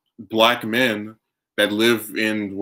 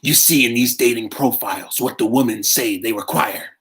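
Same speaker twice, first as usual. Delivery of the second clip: anime villain; 2 semitones higher than usual. Acted. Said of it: "talking like an anime villain now".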